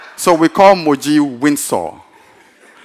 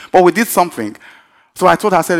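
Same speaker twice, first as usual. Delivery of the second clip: usual speaking voice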